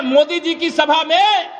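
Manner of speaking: usual speaking voice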